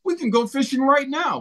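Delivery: lightly voiced objector, pitched well up; imagined skeptical listener. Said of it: "some folks would be hearing this right now and they'd say".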